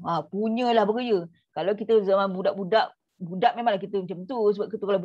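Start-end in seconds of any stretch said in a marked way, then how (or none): none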